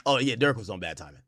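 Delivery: ordinary talk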